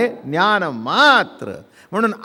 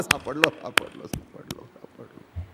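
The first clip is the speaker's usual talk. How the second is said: fading repetition; 6 semitones lower, 12 dB quieter than usual. Acted. Man discovering he is found, repeating it as it fades. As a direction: drop away steadily into a near-silent whisper.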